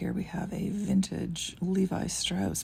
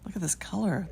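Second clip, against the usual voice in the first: ordinary talk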